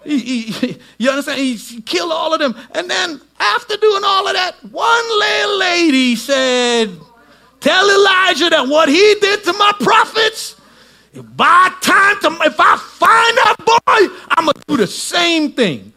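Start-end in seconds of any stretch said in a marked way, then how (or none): 1.4-6.9 loud, high, shouting preacher building; rising shouted sermon climax; full-force preaching. Acted. 7.6-14.2 high, loud shouted threat of a woman; Jezebel's threat shouted. Retold or imitated. 14.4-15.9 loud, high, shouting preacher building; rising shouted sermon climax; full-force preaching. Acted.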